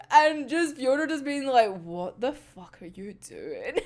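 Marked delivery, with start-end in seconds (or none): 1.8-3.8 low, slow, hushed mock bafflement; quiet disbelief. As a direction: mutter it slowly in acted disbelief. Retold baffled reaction to the pick.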